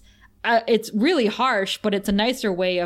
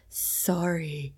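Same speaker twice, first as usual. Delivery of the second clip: flat bored apology, softer; 4 semitones lower, 7 dB quieter than usual. Retold or imitated. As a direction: say it like you couldn't care less. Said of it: "in his bored voice".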